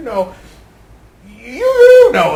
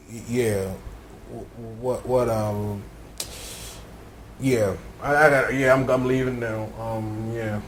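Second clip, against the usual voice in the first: much lower, quiet stammer; shy mumble; 10 semitones lower, 11 dB quieter than usual. Acted. Hero stammering he's leaving, low and quiet. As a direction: mumble awkwardly.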